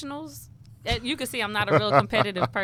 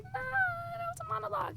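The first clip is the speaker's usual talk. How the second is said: much higher, soft, sing-song brush-off; dismissive mock reply; 6 dB quieter than usual. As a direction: wave it away in a high silly voice. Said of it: "And I was just like".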